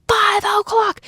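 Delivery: five o'clock shouted high and loud; stressed five o'clock. Acted. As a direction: hammer the words five o'clock. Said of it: "Heavy on the five o'clock".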